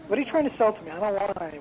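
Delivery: ordinary delivery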